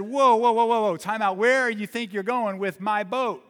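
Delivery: put-on loud and high, halting the kid; stern stop. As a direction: store owner calling out to a thief. Store owner stops the boy.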